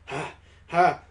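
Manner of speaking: acted panting after a long run; heavy panting. Daddy collapsing after galloping through fields, panting heavily. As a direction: puff out the breaths heavily. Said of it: "panting heavily".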